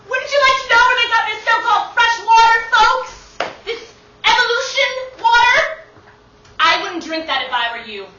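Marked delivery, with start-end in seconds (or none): 0.1-5.8 higher, louder public challenge to passersby; rally the crowd against the rival; calling out to crowd. Acted.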